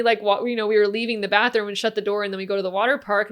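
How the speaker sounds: normal talking voice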